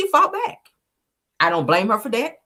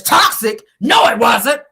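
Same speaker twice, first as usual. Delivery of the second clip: higher-pitched mock outrage; indignant mock denial; 6 semitones higher, 5 dB louder, slower than usual. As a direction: deny it hotly, imitating an offended man. Retelling Martell's indignant denial.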